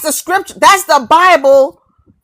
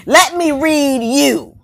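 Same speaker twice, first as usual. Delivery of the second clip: slow and deliberate; slower than usual. Real, not acted. Slow emphatic demand.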